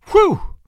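loud, high whistling whew; relieved whew. A narrator's reading. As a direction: blow out a big startled whew. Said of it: "said the drummer, mopping his brow".

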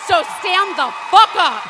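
loud angry demand; angry shout. Real, not acted. Demanding men stand up for the right to choose, angry shout. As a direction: slam the order home. Rant climax.

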